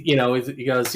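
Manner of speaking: usual speaking voice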